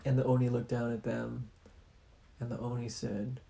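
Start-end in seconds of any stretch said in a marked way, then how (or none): none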